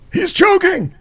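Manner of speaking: high-pitched alarmed yell; retold yell. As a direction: imitate a panicked driver shouting for help. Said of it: "he yells this".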